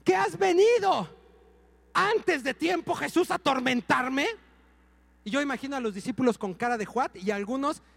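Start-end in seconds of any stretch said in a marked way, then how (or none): 0.0-4.2 más agudo, fuerte y lento, como demonio; zombie imitado a gritos. Retold or imitated. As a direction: gritarle a Jesús como demonio. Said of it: "El zombie". (pastor imita al demonio gritándole a Jesús)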